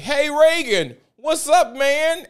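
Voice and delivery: high, loud, overfriendly "hey Reagan"; mock bro greeting. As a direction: call out to her like a long-lost buddy. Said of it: "my homeboy was like".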